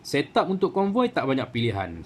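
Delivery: ordinary talk, nothing marked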